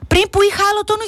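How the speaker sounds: high, indignant protest; mock reproach, pitch leaping up. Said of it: "δεν μπορώ να το κάνω σε αυτόν τον τόνο". (αγανακτισμένος ψηλός τόνος)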